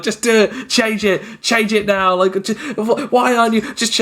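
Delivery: high, raging demand. Retold or imitated. Angry player demanding the GM change the roll. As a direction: fire it off in a furious rant. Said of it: "starts screaming at me to retcon".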